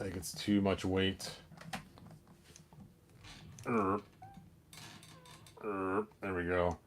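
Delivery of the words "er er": normal talking voice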